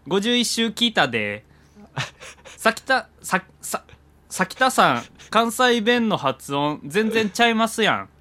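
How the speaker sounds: hammed-up Kansai accent, high and slow; Kansai dialect. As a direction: read the mail in playful Kansai dialect. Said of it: "メールにも関西弁が". (関西弁で大げさに読む)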